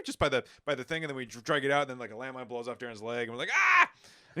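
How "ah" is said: high-pitched acted yelp of shock; short high cry of alarm; mock scream. Friends seeing a landmine blow off a leg, mock scream.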